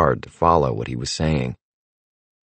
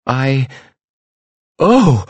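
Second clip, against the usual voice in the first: high, sudden start of shock; startled gasp; 9 semitones higher, 5 dB louder than usual. A narrator's reading. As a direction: cry out in alarm. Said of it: "The fortune teller jerked back".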